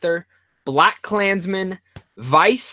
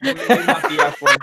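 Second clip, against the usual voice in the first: laughing; 8 semitones higher than usual. Real, not acted. Laughing after troll yell.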